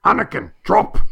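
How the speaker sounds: Qui-Gon Jinn shouting a warning; sudden urgent movie shout; Liam Neeson yell. Retold or imitated. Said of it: "and he yells".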